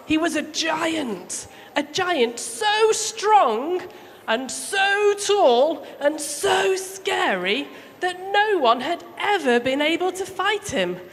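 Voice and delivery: drawn out, high, slow build on the giant; dramatic storytelling. A narrator's reading. Storyteller to children, spooky slow build. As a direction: stretch it out to thrill the kids.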